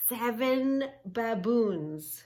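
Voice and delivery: slow, loud title call; dramatic announcing. Shown as a demonstration. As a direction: stretch each word for the kids. Repeating the page title.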